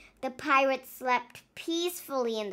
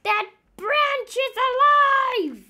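loud, high, slow cry of fright; terrified cry; 11 semitones higher, 10 dB louder, slower than usual. Acted. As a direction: yell it in panic. Paul terrified.

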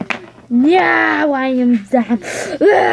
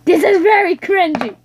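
higher, louder exclaim; emphatic; 6 semitones higher, 4 dB louder than usual. Real, not acted. Speaker admitting the video is cringy, higher louder declaration. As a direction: declare it with embarrassed glee.

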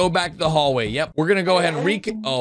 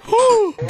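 sharp gasp; 5 dB louder than usual. Real, not acted. Red revealed as imposter.

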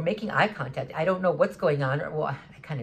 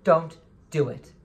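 slow, louder firm warning; 5 dB louder, slower than usual. Retold or imitated. Speaker warning a stranger who confronts him, slow firm warning. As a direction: stop him cold with a warning. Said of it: "I just said to him".

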